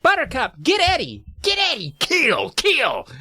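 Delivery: very high-pitched attack command; acted yell. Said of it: "whistles again and yells".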